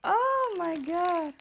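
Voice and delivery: high, loud, slow stunned exclaim; stunned gasp. Real, not acted. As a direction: draw it out in stunned joy. Still reeling from the proposal.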